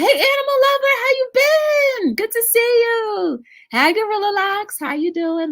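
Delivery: very high, squealed hellos; gushing, high-pitched welcome; excited shout-outs. Real, not acted. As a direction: greet each one in a high, sing-song call. Calling out greetings to chat.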